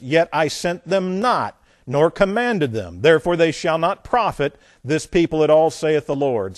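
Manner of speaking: loud, emphatic Bible reading; forceful scripture reading. Acted. Preacher reads judgment on lying prophets to congregation, forceful scripture reading. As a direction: hammer the verse home.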